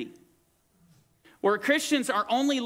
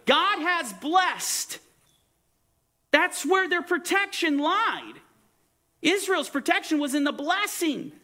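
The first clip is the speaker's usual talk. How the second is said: higher, drawn-out pulpit declaration; raised preacher's proclamation; emphatic preaching; 8 semitones higher than usual. Real, not acted. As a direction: drive the point home slowly. Preaches the point home.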